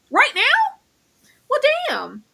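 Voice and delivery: high, hammed-up dismay; a squeaky melodramatic lament; mock dismay. Mock dramatic reaction to goodbye.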